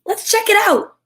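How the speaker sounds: high, bold little cub; high eager cub. Acted. Simba urges Nala on, high and eager. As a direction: sound eager and a bit cocky.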